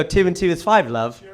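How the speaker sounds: put-on British accent, dropping to an offhand "love". Shown as a demonstration. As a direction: say it like a confident Brit. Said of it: "everything sounds right in that accent".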